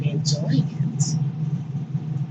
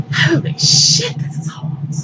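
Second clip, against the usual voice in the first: lightly voiced Bering; 9 semitones higher than usual. Bering pulls back, awed and breathless. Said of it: "try and fail to imitate".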